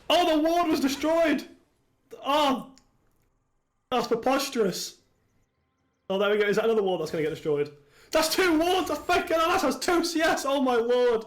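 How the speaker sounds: high-pitched, astonished caster; excited play-by-play. Real, not acted. Caster reacts to wards being destroyed, excited and high. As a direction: call it high, in excited disbelief.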